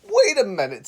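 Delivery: high, loud cartoon zebra; sudden high animal voice. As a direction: pipe up as the bright storybook zebra.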